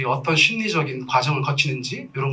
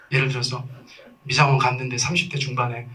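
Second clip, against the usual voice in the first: ordinary delivery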